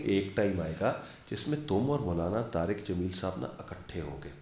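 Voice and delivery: hushed, tender imitation of his mother; gentle motherly advice. Mother's prophecy voiced in story.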